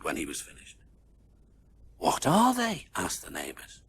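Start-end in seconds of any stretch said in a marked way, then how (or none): 2.0-2.9 curious question. A narrator's reading. Neighbors ask curiously.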